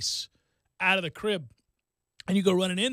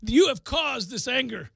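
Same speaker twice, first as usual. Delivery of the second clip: mock anger; 7 semitones higher than usual. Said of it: "he just gets angry".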